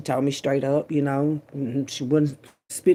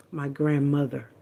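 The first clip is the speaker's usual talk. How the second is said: ordinary delivery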